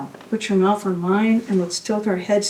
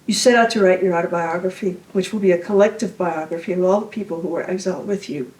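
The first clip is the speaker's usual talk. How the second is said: ordinary delivery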